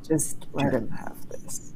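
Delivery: pleading whisper under the breath. Acted. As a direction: whisper it so only Zord hears. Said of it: "go and whisper to Zord".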